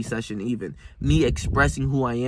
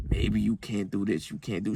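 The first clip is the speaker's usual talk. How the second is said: doubting inner voice; 6 semitones lower, 7 dB quieter than usual. Retold or imitated. Lower self whispering self-doubt, low and soft. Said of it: "my brain is telling me".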